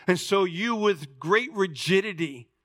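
ordinary talk

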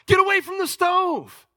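high, loud emergency shout; urgent warning yell. Acted. Grandfather to grandson heading for a hot stove, urgent yell. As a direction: yell to stop a child in danger. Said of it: "I'm gonna yell at him".

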